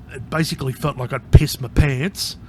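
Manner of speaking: usual speaking voice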